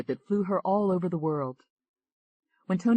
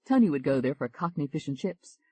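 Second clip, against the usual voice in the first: usual speaking voice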